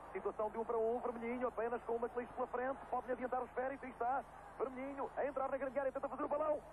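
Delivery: rising tension, building to the goal; fast dramatic football commentary. Real, not acted. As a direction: build excitement as the attacker closes in.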